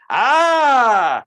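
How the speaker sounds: open-mouthed frightened ah; scared gasp. Shown as a demonstration. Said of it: "a bit like somebody who's scared".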